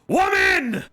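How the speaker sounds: high desperate shout. Acted. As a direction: yell it like a lovesick emperor. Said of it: "just goes".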